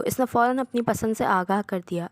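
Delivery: ordinary delivery, nothing marked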